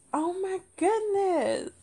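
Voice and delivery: high, slow, drawn-out lament; mock dismay. Comedian reliving the moment it died, mock dismay. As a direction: wail it slowly in theatrical despair.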